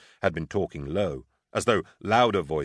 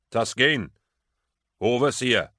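raised, high searching calls; calling out; 5 semitones higher, 4 dB louder than usual. A narrator's reading. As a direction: call out, waiting for an answer. Calling into the dark for companions.

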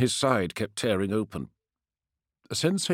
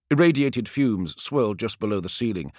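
ordinary delivery

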